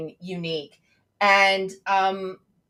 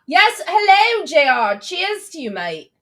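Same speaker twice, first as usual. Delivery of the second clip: mock British cheer; 8 semitones higher, 8 dB louder, slower than usual. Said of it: "my accent goes all over the place".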